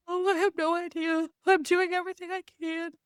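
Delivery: high, put-on voice playing dumb; mock innocent self. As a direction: pitch it up like a clueless kid. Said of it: "I was like".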